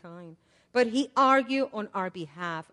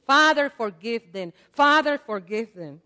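louder, pleading prayer; fervent prayer, loud; 8 dB louder than usual. Acted. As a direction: call it out to heaven. Preacher voicing Jesus' prayer.

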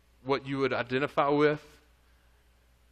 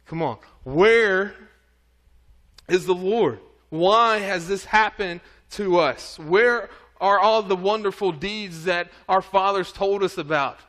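higher and louder, pleading protest; exasperated complaint; 4 semitones higher, 6 dB louder than usual. Acted. Gideon protesting to the Lord about Midian, exasperated and loud. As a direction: demand answers, worn out and bitter.

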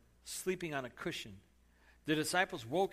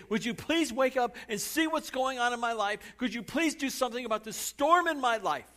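higher, louder pleading demand; desperate plea; 8 semitones higher, 7 dB louder than usual. Acted. Believer pleading loudly with God about their storm. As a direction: shake him awake with your words.

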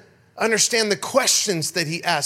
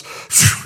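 whoosh; 3 dB louder than usual. Retold or imitated. Guy rips pocket off.

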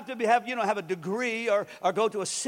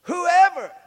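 whoever shouted high and loud; preacher stressing the key word; punched word; 9 semitones higher, 10 dB louder than usual. Real, not acted. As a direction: hit whoever hard. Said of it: "listen to what he says".

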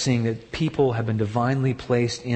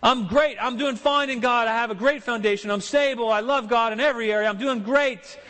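high, breezy fake contentment; mock smug; 9 semitones higher than usual. Preacher mocking the self-deceived believer, breezy. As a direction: mimic someone claiming all is fine.

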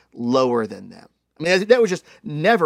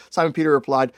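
usual speaking voice

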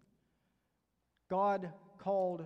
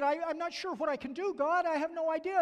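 pleading Moses; 6 semitones higher, 5 dB louder than usual. Retold or imitated.

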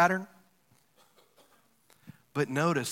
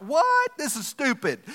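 mock outraged what; 8 semitones higher, slower than usual. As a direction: grumble in disbelief. Soldiers grumbling about marching.

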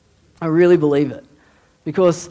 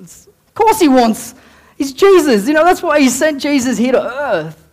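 loud, high-pitched impassioned correction; emphatic preaching; 8 semitones higher, 6 dB louder than usual. Real, not acted. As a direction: burst out with full conviction. Emphatic answer to the timid prayer.

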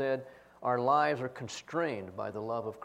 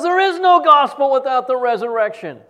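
much higher, much louder stern lecture; stern shout; 12 semitones higher, 16 dB louder than usual. Retold or imitated. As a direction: drive the point home at full volume. Professor still yelling.

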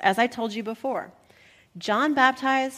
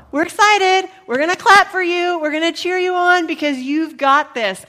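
higher, louder cheerleader enthusiasm; excited cheering; 7 semitones higher, 8 dB louder than usual. Acted. Disciples telling Jesus they will cheer him on, excited. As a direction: gush eagerly, like fans rooting someone on.

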